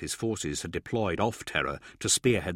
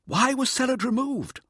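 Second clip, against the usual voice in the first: very high-pitched query; high, insistent civilian; anxious questioning. A narrator's reading. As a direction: ask with voice shot right up. Mirza questioning the hussar.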